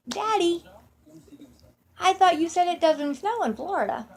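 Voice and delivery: put-on high-pitched small child's voice; imitated little girl. Little girl questioning her dad about Florida snow, imitated child voice. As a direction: play a puzzled little daughter asking Daddy. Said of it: "Janine looks up at my dad and says".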